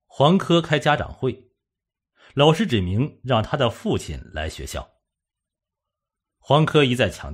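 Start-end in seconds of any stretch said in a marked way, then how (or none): none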